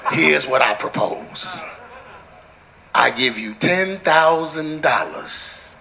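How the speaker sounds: low, slow, soft-spoken offer; retold smooth proposition. Preacher retelling a football player's music offer, low and slow. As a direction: pitch the deal low and unhurried. Retelling a Dallas Cowboy's pitch.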